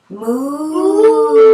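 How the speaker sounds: loud, high playful moo; cow moo. Shown as a demonstration. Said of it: "Moo like a cow".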